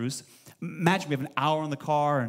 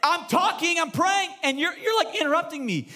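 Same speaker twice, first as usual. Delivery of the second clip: exasperated retort; 11 semitones higher than usual. Retold or imitated. Pastor retells telling his boss he keeps interrupting, exasperated. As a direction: insist like a frustrated young pastor. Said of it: "I go".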